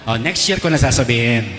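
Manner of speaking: ordinary talk, nothing marked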